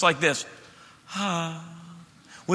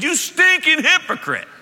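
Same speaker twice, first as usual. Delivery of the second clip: much higher, louder, slow yell with a laugh; a jokey, over-the-top shouted insult; mock angry outburst; 11 semitones higher, 6 dB louder than usual. Preacher joking how he himself would rebuke, mock yell. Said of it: "if it were [name]".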